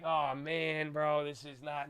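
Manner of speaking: soft, deflated under the breath; dismayed mutter. Retold or imitated. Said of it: "I'm like".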